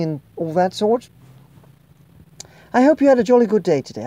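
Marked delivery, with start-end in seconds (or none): 2.7-4.0 jolly cheerful. Acted. Cheery sign-off to viewers.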